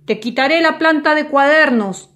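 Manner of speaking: loud, high furious roar; roaring threat. A narrator's reading. Salesman threatens to take Bartolo's plant, roaring. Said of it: "rugió con su gran boca negra de horno".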